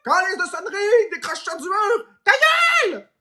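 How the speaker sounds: high-pitched angry outburst; acted exasperated yelling. Cursing the ringing phone on the wall, high exasperated yell.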